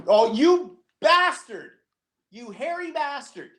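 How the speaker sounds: high, slow playful indignation; mock outrage. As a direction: scold him jokingly for the treat. Mock outrage at being tempted.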